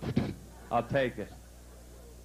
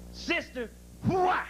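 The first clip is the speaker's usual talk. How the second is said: flasher's whoop; much slower than usual. Retold or imitated. Flasher surprising the nun on the bench, high whoop.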